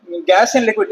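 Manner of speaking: slow, with a stress on in; careful slow classroom read. Shown as a demonstration. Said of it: "देखो इसको ऐसे पढ़ना".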